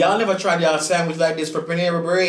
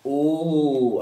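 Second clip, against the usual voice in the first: ordinary talk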